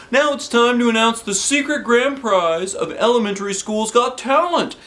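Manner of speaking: high, bright showman's welcome; announcer voice. Acted. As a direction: announce it to the crowd. Principal announcing on stage.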